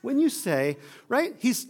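ordinary delivery

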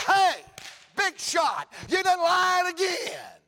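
high, loud jeer with stretched last words; taunting shout. Acted. Preacher telling off the devil, taunting triumphant shout. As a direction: shout down a big shot in triumph.

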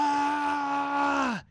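a sudden high shriek of delight; joyful shriek. Retold or imitated. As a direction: shriek with joy on seeing the gift. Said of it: "I just started going like".

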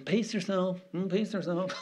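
high, prim little caution; high-pitched mock scolding; mock timid church. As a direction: parody the church in a small high voice. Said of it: "And the church is".

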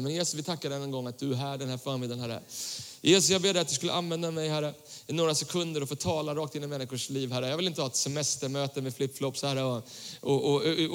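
soft, low-pitched earnest prayer. Real, not acted. Preacher asks Jesus to use him in this meeting, praying softly.

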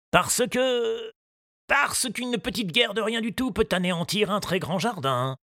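earnest gardener voice; explaining to the boy seriously; gardener Moustache. A narrator's reading. Moustache explains war to Tistou, earnest.

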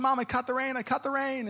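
put-on high, soft child voice; small, high voice telling mom proudly; imitated little girl. Little girl catching raindrops with mom, imitated child voice.

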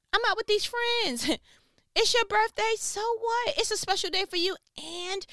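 hammed-up teen snub, pitched very high; dismissive teenager. Teenager choosing friends over parent's birthday, dismissive.

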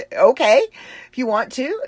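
high-pitched, amused okay; amused agreement. Retold or imitated. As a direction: agree through the tail of a laugh. Retold reply after laughing at request.

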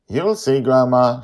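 high, playful secret-keeping; teasing girl. A narrator's reading. Joy teases Grandma Susie, high and playful.

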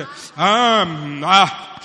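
loud, halting prophet act; mock prophesying. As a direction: imitate a preacher calling out prophecies. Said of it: "sit there and say".

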